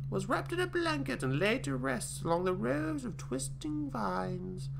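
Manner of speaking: high, put-on old British granny. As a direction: croon it like a creepy old granny reading her diary. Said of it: "I sound very British".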